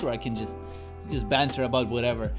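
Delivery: normal talking voice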